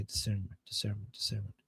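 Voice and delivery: low, very soft self-rehearsal; practising pronunciation. Real, not acted. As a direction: sound out the word quietly to yourself. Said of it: "Let me pronounce". (trying the word out under the breath)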